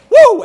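high, loud whoop; sudden comic whoop of surprise; whooped "woo". Acted. Dead man springs from grave.

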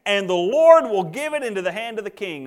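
high, loud, drawn-out sarcasm; Micaiah. Acted. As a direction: mockingly proclaim it like an overeager prophet. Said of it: "can't pick up on the sarcasm there".

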